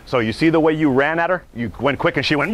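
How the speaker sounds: usual speaking voice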